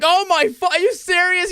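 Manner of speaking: high, loud incredulous outburst; shocked disbelief. Retold or imitated. Players reacting to the Mustang request, high shocked disbelief. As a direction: act out the whole table gasping in disbelief. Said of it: "everyone's like".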